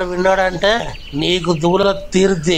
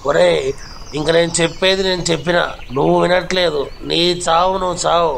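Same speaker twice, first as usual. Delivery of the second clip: ordinary talk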